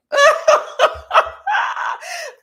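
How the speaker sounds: loud high shrieking cackle; high shrieking laugh. Real, not acted. Cracking up at the fifty-seven joke, shrieking laugh. Laughing at Voodoo Doll comment.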